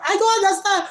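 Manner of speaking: furious cry of a grieving brother; livid shout. Retold or imitated. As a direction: yell at the villagers in disbelief. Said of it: "he is totally livid".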